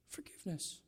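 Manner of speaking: a soft whispered "Forgiveness", almost no voice. Shown as a demonstration. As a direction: breathe the word "Forgiveness" as a hushed whisper. Keyword repeated in a whisper.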